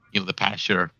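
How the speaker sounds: ordinary talk, nothing marked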